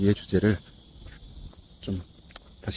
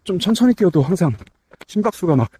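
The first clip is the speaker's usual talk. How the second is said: talking mid-jog, a bit winded; while running. Real, not acted. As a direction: speak while running at an easy pace. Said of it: "이제 막 뛰기 시작했고".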